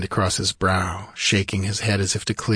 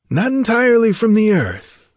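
high, lilting, louder reply; wryly amused sorcerer, high-voiced; 13 semitones higher, 6 dB louder than usual. A narrator's reading. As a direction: correct him with amused irony, pitch held high. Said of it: "with a wry smile".

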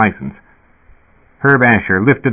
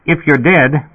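higher-pitched reasoning; lightly voiced dispatcher, pitched up; sputtering intercom speaker; 6 semitones higher, slower than usual. A narrator's reading. Dispatcher arguing with the man who claims death, sputtering. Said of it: "the speaker sputtered".

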